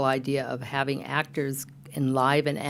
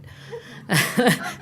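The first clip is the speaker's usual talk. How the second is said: laugh; 8 semitones higher than usual. Real, not acted. Host laughs at own word choice.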